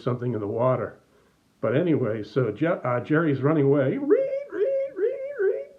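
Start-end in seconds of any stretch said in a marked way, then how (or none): 4.0-5.7 guinea pig squeak. Retold or imitated. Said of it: "run away like a guinea pig".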